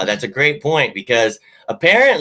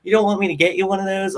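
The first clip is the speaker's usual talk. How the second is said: high, quick imitation of a girlfriend; girlfriend's voice; 10 semitones higher, faster than usual. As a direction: quote her in a higher, quicker voice. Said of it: "She's like".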